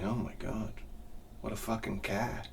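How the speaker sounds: low, soft awestruck murmur; awed hush at a fancy car; hushed awe. Retold or imitated. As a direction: breathe it out in quiet amazement. Awed at wife's friend's Audi.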